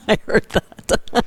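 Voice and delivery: breathy laugh through words. Real, not acted. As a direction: say it while laughing. Laughing, unvoiced.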